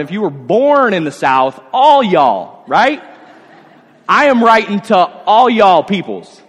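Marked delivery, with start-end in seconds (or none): 1.7-6.4 real Southern. Retold or imitated. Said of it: "Or if you're real Southern".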